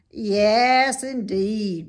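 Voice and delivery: an obliging rat agreeing; eager rat voice; Templeton the rat. A narrator's reading.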